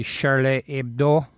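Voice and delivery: put-on Charlie Hebdo said with a light French accent, Hebdo sounding French. Commentator naming the French magazine, in a lightly French accent. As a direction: slip briefly into a French accent on the name. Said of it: "Pardon my French accent".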